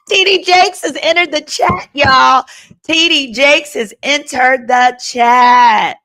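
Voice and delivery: high, loud announcer call, stretched out the second time; mock announcement. Livestream host heralds a pastor's arrival to viewers, loud mock announcement. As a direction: proclaim it loudly to everyone, drawing out the ending.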